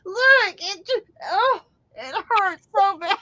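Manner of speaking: high whiny fake sob; mock crying husband. Husband faking pain to his wife, mock crying. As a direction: whimper it like a big baby. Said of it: "He goes".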